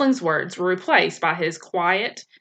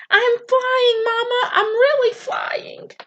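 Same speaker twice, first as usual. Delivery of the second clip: very high, delighted bird voice, slowing at the end; overjoyed gosling; slower than usual. A narrator's reading. Gosling calls to his mother while flying, very high and overjoyed. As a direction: call out in pure joy, drawing out the last words.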